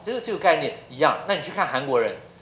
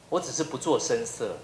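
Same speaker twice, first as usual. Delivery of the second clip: usual speaking voice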